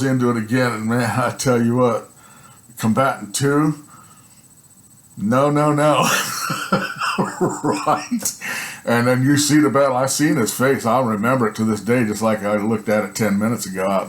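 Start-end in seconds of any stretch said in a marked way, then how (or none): 6.1-7.6 laugh. Real, not acted. Laughing at the loser.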